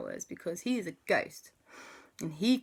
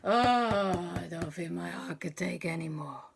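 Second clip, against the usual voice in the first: 1 shaken relief. Acted. Relieved after close call.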